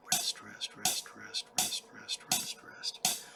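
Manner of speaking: soft unvoiced whisper; whispered rests. Shown as a demonstration. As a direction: breathe the rests out quietly.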